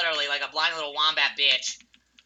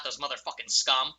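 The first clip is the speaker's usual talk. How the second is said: normal talking voice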